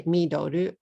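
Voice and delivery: "midoru" in three Japanese syllables; katakana pronunciation. Shown as a demonstration. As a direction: say "midoru" as mi-do-ru, final L turned to ru. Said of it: "You don't want to say".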